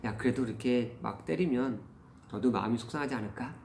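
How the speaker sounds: retold tentative, low-key advice to a child; casual gentle probing. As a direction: slip in a careful word of doubt. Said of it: "아이에게 슬쩍 얘기한".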